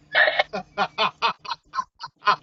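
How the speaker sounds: loud real chuckle; teasing laugh. Real, not acted. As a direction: laugh at the friend. Laughs after teasing Matty.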